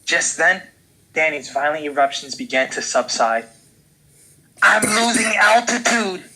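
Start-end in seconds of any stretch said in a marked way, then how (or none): 4.6-6.2 high, loud shout. Acted. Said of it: "he shouted".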